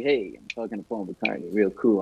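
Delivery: deliberately nonchalant; playing it cool. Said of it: "on the outside, I'm like".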